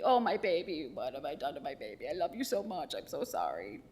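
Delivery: a lightly voiced doting mom making up; mock tender apology. Mother soothing daughter after a smack, soft and doting. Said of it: "And then she'd be".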